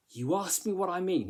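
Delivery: accusatory reading, "me" lifted high; finger pointing. Shown as a demonstration. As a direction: read it high and accusing, stress on "me". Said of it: "quite finger pointing".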